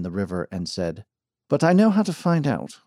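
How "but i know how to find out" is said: higher, louder English gentleman; Englishman voice. Acted. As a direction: lift the pitch, sound resolved. Englishman resolves to act.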